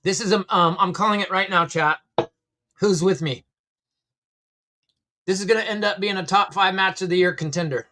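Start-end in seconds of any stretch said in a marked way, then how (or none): none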